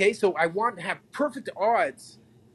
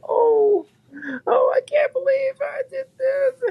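hammed-up sobbing whisper, much higher; mock sobbing wife; 7 dB louder, slower than usual. Husband mimics imagined wife at door, mock sobbing whisper. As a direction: fake a tearful wife's whisper, overdone. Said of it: "my wife to be crying at the door".

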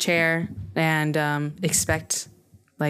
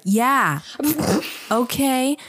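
higher-pitched imitation of a hype man; hype-man cheering; 7 semitones higher, much slower than usual. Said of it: "she's the inside hype man".

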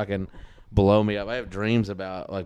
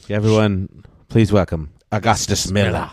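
loud mock band introduction; stage announcer; 7 dB louder than usual. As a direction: belt it out like a concert MC.

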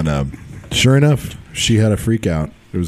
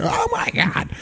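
much higher squealed fangirl gasp; 4 dB quieter, slower than usual. Retold or imitated. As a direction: imitate a starstruck fan losing it. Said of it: "did one of those, like, straight fangirl things".

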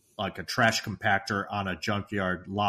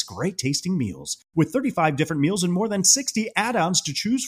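higher, swooping sponsor-read voice; podcast ad read; 5 semitones higher, 4 dB louder than usual. Acted. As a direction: sell it with punched, bouncy emphasis. Host reads sponsor message.

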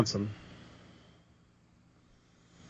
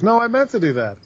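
mock Bayley excuse; 9 semitones higher than usual. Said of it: "Bayley would be like".

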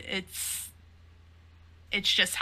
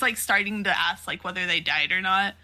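normal talking voice

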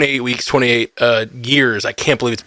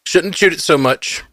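mouth burning from spicy food; slower than usual. Real, not acted. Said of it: "My mouth is burning".

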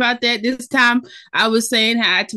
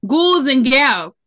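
slow, drawn out; 5 semitones higher, 6 dB louder, much slower than usual. Shown as a demonstration. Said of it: "ghouls is a universal tone".

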